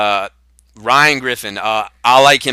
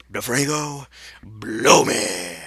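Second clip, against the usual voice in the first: normal talking voice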